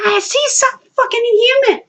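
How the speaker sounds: high, frantic re-enacted outburst; shrieky retold panic; upset retort. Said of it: "I was so upset".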